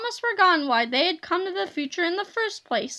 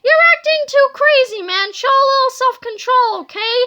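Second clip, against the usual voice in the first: high, loud scolding; exasperated cry; 8 semitones higher, 9 dB louder than usual. A narrator's reading. Queasy boy scolds his overexcited friend, high and loud. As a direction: tell your friend off at full voice. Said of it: "cried Harold".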